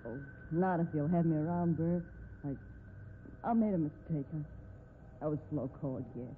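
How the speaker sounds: low, soft, halting confession; tender apology. Acted. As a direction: own up gently and sincerely. Tender reconciliation.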